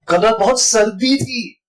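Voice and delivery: सर्दी stretched high and slow; stress on सर्दी. Shown as a demonstration. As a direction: lean on सर्दी at the end. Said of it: "इन सब का मतलब अलग है". (सर्दी शब्द पर ज़ोर)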